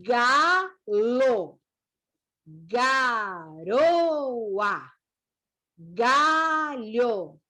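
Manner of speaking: slow, drawn-out syllables, each vowel held; syllable-by-syllable reading. Shown as a demonstration. Teacher rereading the word list with learners, slow and syllabified. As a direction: stretch every word so a beginner can follow.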